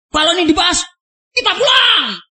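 hammed-up furious threat of leaving; angry shouted threat. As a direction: voice jumps high into a retold yell. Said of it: "berteriak keras".

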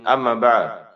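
ordinary talk

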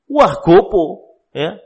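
slow exasperated parent voice; a lightly voiced fed-up parent; mock parent. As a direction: drag it out, an annoyed parent. Acted parent reacting to crying.

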